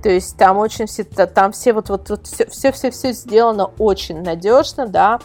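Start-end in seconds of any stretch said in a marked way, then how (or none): none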